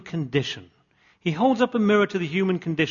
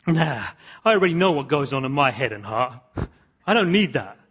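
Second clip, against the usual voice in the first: ordinary delivery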